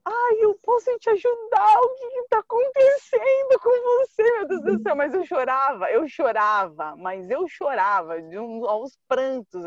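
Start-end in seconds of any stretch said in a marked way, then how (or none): 0.0-4.9 much higher and louder, fussing concern; worried old lady. Retold or imitated. Elderly woman finds her crying on the sand, high fussing worry. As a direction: play a flustered lady asking if she's ok. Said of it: "uma senhora passou por mim".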